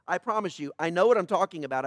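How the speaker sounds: ordinary delivery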